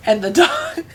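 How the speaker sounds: high laughing squeak; words broken by laughter; can't hold back the laugh. Real, not acted. Laughing while telling.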